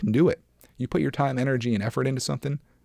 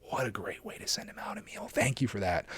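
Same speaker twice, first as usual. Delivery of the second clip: hushed whisper; 9 dB quieter than usual. Real, not acted. Moved by a motivational quote.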